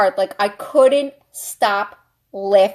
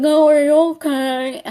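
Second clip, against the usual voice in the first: put-on voice, imitating the guy; mock concerned guy; 4 semitones higher than usual. Guy checking on her after the fall, mock concern. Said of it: "he's like".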